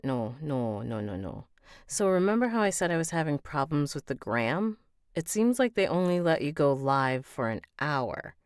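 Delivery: ordinary delivery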